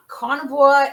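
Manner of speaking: ordinary talk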